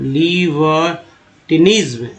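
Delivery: लिबर्टिनिज्म slowly, निज्म drawn long; slow pronunciation. Shown as a demonstration. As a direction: sound out लिबर्टिनिज्म in parts. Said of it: "अच्छे से पकड़िए".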